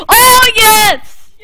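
high, loud cry of victory; triumphant shout. Real, not acted. Player cheering a win in the game, triumphant shout.

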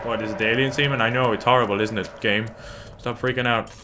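ordinary talk

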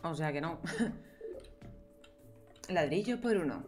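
ordinary talk

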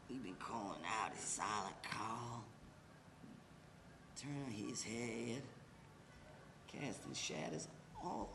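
low, slow and hushed; drowsy whisper. Acted. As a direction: drift off muttering. Drunk reverie before sleep.

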